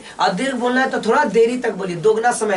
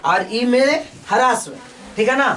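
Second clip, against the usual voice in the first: ordinary talk, nothing marked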